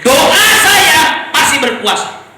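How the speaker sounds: loud, slow declaration from the pulpit; preaching at full force. Real, not acted. Preacher to congregation, shouting with conviction.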